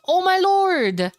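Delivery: loud, high, drawn-out cry; excited exclamation. Real, not acted. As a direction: belt it out long in amazement. Caster reacts to the game.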